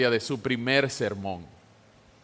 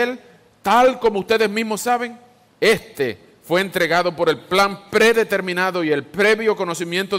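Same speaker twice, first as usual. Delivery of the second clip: raised, ringing public reading of a sermon; proclaimed scripture reading; 3 semitones higher than usual. Acted. Preacher reading Peter's first sermon to the congregation, declaimed with force. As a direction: proclaim it to a crowd, lifting the pitch.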